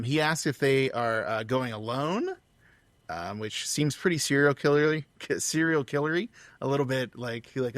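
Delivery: ordinary talk, nothing marked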